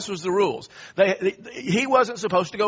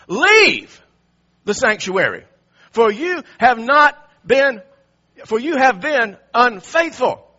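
loud, emphatic priestly rebuke; stern confrontation; 3 semitones higher, 6 dB louder than usual. Acted. Priests confronting King Uzziah in the temple, stern and forceful. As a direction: punch the key words like a public reprimand. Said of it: "They confronted him and said".

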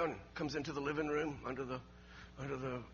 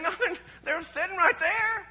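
frustrated insistence; 13 semitones higher, 12 dB louder than usual. Retold or imitated. Husband insisting to wife his friends are there, frustrated. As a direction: insist loudly, stretching the words. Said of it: "he gets a little frustrated".

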